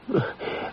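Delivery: put-on soft airy old man's laugh; a little knowing laugh under the breath; quiet unvoiced chuckle. Old lawyer amused by question.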